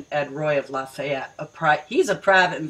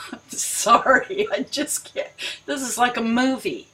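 laughing; 6 semitones higher than usual. Real, not acted.

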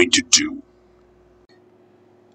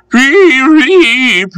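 long, very high engine toot; whistle call; 5 dB louder than usual. A narrator's reading. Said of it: "he called the next moment".

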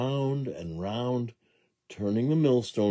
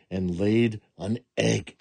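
usual speaking voice

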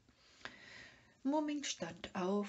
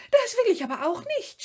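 high, loud, fast shriek; 16 dB louder, much faster than usual. A narrator's reading. Sniff refusing to walk ahead, shrieking high and loud. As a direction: screech it in panic. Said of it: "schrie das Schnüfferl".

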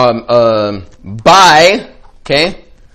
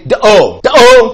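loud cartoon catchphrase, climbing higher; Homer Simpson; 8 semitones higher, 13 dB louder, slower than usual. Presenter shows what Homer is saying, loud cartoon exclamation. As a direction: belt it out, second one pitched higher. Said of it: "he's saying".

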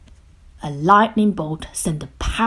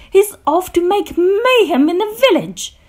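high, loud gleeful shout; 12 semitones higher, 7 dB louder than usual. A narrator's reading. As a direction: shout the news excitedly. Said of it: "shouted Grump".

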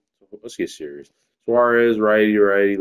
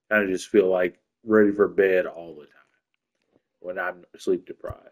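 ordinary delivery

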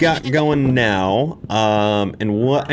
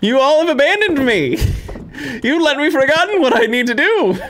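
higher, louder mock accusation, laughing; playful outrage at chat; mock indignant outcry; 13 semitones higher, 3 dB louder than usual. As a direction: ham up the betrayal. Mock blaming the viewers.

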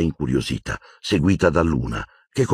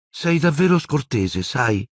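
unruffled remark; 8 semitones higher, slower than usual. A narrator's reading. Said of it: "osservò imperturbabile Luna".

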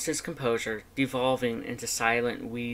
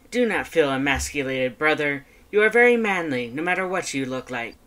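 Papyrus voice; 2 semitones higher, 7 dB louder than usual. Acted. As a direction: boom out mock comfort.

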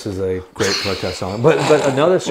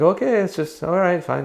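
mock Norwegian accent; 7 semitones higher than usual. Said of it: "He's like".

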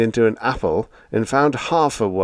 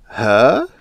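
innocently; 4 semitones higher, 7 dB louder than usual. A narrator's reading. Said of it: "said Biggs innocently".

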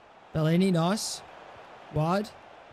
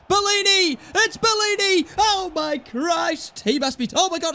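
excited shout; 10 semitones higher than usual. Real, not acted. Gamer sees his player break through, high excited shout.

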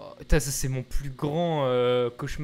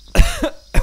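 violent choking cough; coughing fit; 13 dB louder than usual. Real, not acted. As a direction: cough hard, choking on a drink. Choking on a drink again.

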